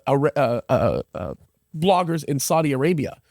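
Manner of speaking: ordinary talk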